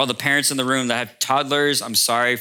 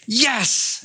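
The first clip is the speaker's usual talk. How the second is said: put-on excited child's yes; 11 semitones higher than usual. Daughter answers excitedly.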